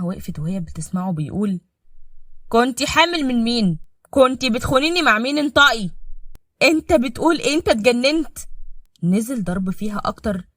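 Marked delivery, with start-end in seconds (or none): 2.5-5.9 loud raised husband yelling; furious accusing. Acted. Husband beating wife, yelling accusations. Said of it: "بتصوت". 6.6-8.4 high, loud panicked wife; frightened protest. Acted. Wife protesting while being hit, scared.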